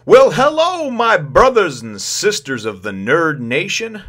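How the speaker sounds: big high call-out hello; showman's hello to the viewers; loud greeting. Real, not acted. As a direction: boom out the welcome.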